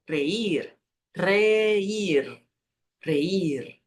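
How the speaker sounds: reír trilled first and tapped last; vibration at both ends. Shown as a demonstration. Teacher modelling the verb, vibrating R. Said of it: "Tem no começo e tem no final".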